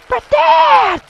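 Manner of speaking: put-on high squeaky child plea; high whiny son. A son whines back at his yelling dad. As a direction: whine it like a little boy.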